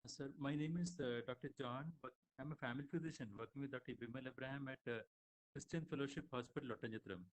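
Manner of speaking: very faint, far from the mic; speaking far off the mic, faint; barely audible. Real, not acted. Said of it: "You are a bit faint".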